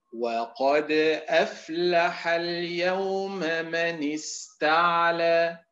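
slow, drawn-out chanted recitation; Quran recitation. Shown as a demonstration. Teacher chanting the verse for students, slow. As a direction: intone the verse slowly.

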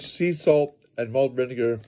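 ordinary delivery

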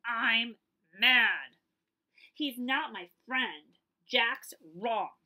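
loud, slow sulky declaration; a cross rabbit shouting his grievance; angry. Acted. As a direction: stamp your foot and announce it. Jack declares he's mad.

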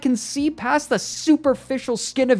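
very high, frantic robot voice; wild heights. A narrator's reading. Robot accuses the scientists, wildly high. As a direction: wail it out, pitched wildly high. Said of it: "Herbie's voice rose to wild heights".

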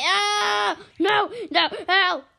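high, loud cries for help; alarmed screaming. Pretend. Player attacked during sign-off, screaming for help. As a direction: scream for rescue.

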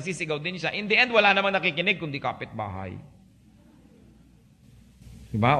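normal talking voice

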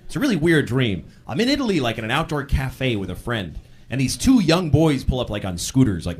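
normal talking voice

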